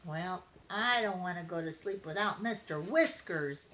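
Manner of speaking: plain reading voice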